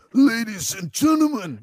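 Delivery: high announcer voice; big concert MC introduction; Howlin' Dave impression. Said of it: "it sounded like him".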